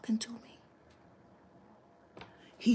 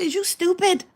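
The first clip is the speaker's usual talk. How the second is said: much higher, louder incredulous shout; shrieking at a friend who won't see the setup; exasperated yell; 10 semitones higher, 8 dB louder than usual. Retold or imitated. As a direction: yell it like he can't believe how dumb he is. Exasperated retort to naive friend.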